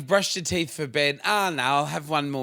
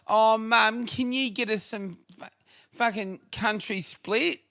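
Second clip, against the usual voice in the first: put-on higher, pleading child whine; whiny kid voice; 7 semitones higher than usual. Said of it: "that whiny kid's voice".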